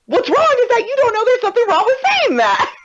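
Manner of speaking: mock exasperated telling-off; exasperated retort. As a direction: retort in disbelief. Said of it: "And I was like".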